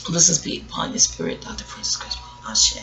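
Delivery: hushed blessing, barely voiced; reverent whisper. Real, not acted. Blessing the late Doctor Francis Cress Westland, whispered. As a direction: whisper it like a prayer for the departed.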